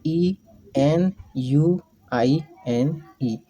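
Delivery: letters एन यू आई एन ई spelled out slowly; slow spelling. Shown as a demonstration. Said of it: "जी". (अक्षर धीरे-धीरे बोलना)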